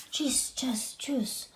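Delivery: whispered chis chas chus; a soft swishing sound; sweeping sound. Acted. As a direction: hush it like a broom brushing.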